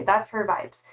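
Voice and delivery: ordinary delivery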